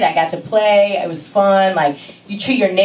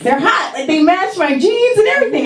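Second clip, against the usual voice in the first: put-on much higher, proud kid voice; young girl proudly defending her outfit; 12 semitones higher than usual. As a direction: insist the sneakers are cool. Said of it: "defending her sneakers as a kid".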